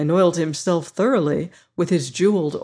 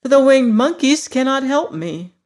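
put-on higher, louder disappointed girl; near tears; 8 semitones higher, 6 dB louder than usual. Dorothy to her friends, near tears and higher. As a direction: let the letdown rise in the voice. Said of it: "almost ready to cry".

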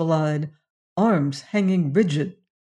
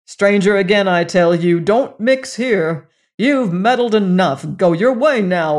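louder angry threat; hot warning; 2 semitones higher, 6 dB louder than usual. Acted. Tull threatening the stranger, angry. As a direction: warn him off hotly. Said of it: "cried Tull hotly".

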